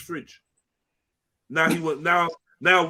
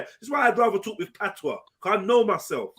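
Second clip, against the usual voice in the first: ordinary talk, nothing marked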